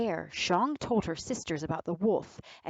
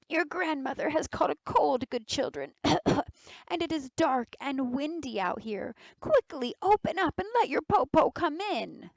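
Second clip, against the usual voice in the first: a wolf faking a sweet, higher granny voice with a fake cough; disguised wolf coaxing the girls to open the door, higher and sugary; wolf posing as granny; 8 semitones higher than usual. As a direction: coax the children in like a sick old grandma.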